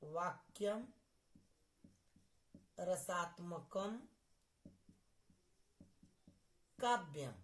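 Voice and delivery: word by word, long pauses between; dictating while writing. Real, not acted. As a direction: say each word as you write it. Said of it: "लिख दे रहे हैं".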